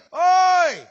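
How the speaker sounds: loud, high "oi"; high-pitched call to attention. Acted. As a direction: shout out a sharp rebuke. Calling out those who blame God.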